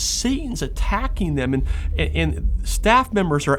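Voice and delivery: impassioned preaching. Acted. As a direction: build it up like a sermon climax. Preaching builds to full force.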